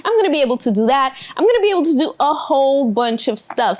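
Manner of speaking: imitating an excited parent, high then racing; mock-thrilled rush of anticipation; happy parent. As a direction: gush rapidly through big plans, starting high. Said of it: "they're kinda happy in the beginning".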